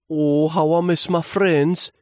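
high, slow little leaf lament; lonely sigh. Acted. Lonely leaf missing his friends, high wistful sighing. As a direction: sigh it out high and loud, drawing it out. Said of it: "he sighed".

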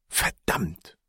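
low, soft muttered swear; muttered curse. A narrator's reading. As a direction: curse under your breath. Narrator's own curse.

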